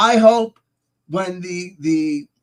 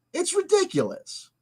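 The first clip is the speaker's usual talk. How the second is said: "It's ridiculous" much higher and softer; 10 semitones higher, 4 dB quieter than usual. Shown as a demonstration.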